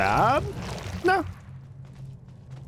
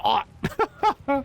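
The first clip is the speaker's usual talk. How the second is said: imitating dumb player; 12 semitones higher than usual. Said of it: "He's like".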